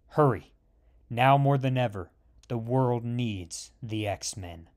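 low, soft, hushed inner voice; professor's hushed telepathic call to Scott; telepathic message. A narrator's reading. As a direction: murmur it into his mind. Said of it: "sends a telepathic message".

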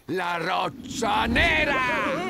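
slow, loud, drawn-out announcement; dramatic shout. Acted. Marten boss shouting the place to his gang. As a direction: boom out the name to impress the gang. Villain names the dangerous place.

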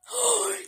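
sharp high gasp; theatrical gasp. Pretend. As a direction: suck in breath in sudden realization. Mock shock before his pun.

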